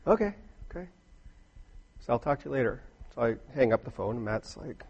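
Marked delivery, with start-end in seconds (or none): 0.0-2.8 gracious wife. Retold or imitated. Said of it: "her gracious self says".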